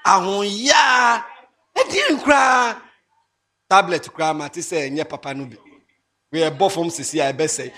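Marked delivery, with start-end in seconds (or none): 0.1-2.8 slow, loud and high; loud, drawn-out preaching; shouted sermon point. Real, not acted. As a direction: drag it out like a preacher.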